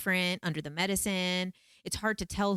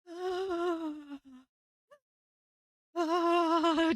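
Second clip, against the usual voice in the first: high, shuddering acted gasps; gasping for breath; 11 semitones higher than usual. Retelling her attempt to catch her breath, panicked gasps. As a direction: gasp and moan as if panicked. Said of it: "trying to, like, catch my breath".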